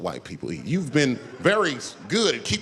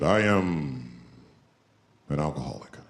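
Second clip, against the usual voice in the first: low, slow, deadpan chief voice; a lightly voiced solemn Native elder; stoic Native man; 12 semitones lower than usual. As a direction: play it solemn and unbothered.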